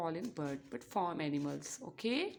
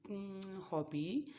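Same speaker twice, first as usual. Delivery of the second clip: normal talking voice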